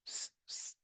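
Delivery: whispered "स-" as a long voiceless s; voiceless s demo; hissed s sound. Shown as a demonstration. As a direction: hiss "स-" with no voice, just the s. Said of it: "से में ध्यान दीजिएगा".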